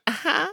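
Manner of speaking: high-pitched witch cackle; old hag cackle. Retold or imitated. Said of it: "This is my witch cackle".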